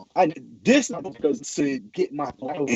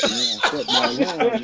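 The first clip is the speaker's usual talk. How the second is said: higher, laughing through it; sheepish laugh; 10 semitones higher than usual. Retold or imitated. Answering the angry coach, a sheepish laughing reply. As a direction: laugh it off guiltily. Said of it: "And I was like".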